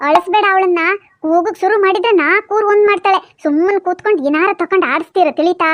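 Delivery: low, quick granny voice giving orders; old grandma voice. Acted. Grandma telling Appu to mind the baby, low and quick. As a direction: play the bossy village grandmother.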